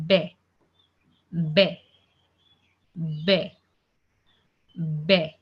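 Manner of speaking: "be" said the French way, short bay; "be" with a French vowel, not bee; French letter B. Shown as a demonstration. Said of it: "the pronunciation of alphabet B in French".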